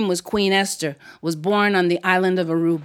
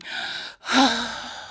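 restless sigh; 2 semitones higher, 5 dB quieter than usual. Acted. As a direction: huff out air like an inattentive child. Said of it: "I started making sounds".

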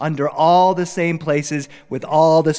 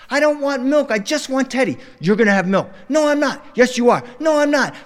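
put-on whiny toddler; 10 semitones higher than usual. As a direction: whine it like a stubborn two-year-old. Toddler arguing with dad.

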